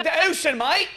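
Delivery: high, exaggerated Aussie "mate"; bad Australian accent. Host redoing the lady's line, hammed-up Australian accent. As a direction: ham up the Australian twang on "mate". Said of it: "worst Australian English accent".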